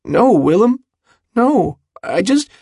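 high, anxious, broken-off protest; plead, trying to explain. A narrator's reading. Jude begs his angry friend to understand, pleading. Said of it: "Jude pleads with him".

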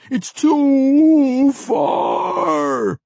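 very high, very slow, loud crying howl. A narrator's reading. The mage howls his despair to the dwarves. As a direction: cry it out as a long dog howl.

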